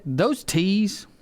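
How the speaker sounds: usual speaking voice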